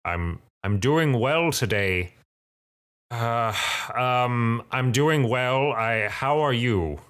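slow, hesitant reply with mild distaste; disgusted sigh. Acted. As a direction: answer reluctantly, a touch disgusted. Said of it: "like, disgusted way".

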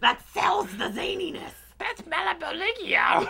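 high goofy voice. Pretend. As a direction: sing out the name goofily. Goofy character voice continued.

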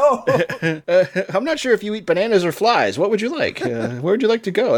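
high, lilting date questions; mock date talk. Host asking the spider out, high and coaxing.

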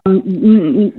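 normal talking voice

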